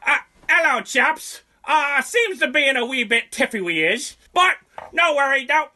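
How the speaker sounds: high, loud put-on British officer accent; jolly British accent, hammed up; mock posh English. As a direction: ham up a clueless English officer. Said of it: "English morons over here".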